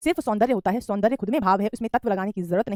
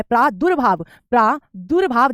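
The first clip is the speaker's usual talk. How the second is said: slow spelled-out; 4 dB louder, slower than usual. Shown as a demonstration. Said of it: "दा के बाद रेफ".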